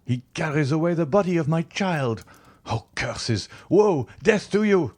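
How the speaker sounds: high, anguished cries rising to a shout; a father screaming curses after the body of his son; frantic cry. A narrator's reading. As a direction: rave in grief at the one taking the child. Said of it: "cried Villefort".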